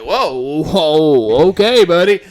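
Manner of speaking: loud, rising mock whoa; taken aback. Teller reacts to blunt proposition.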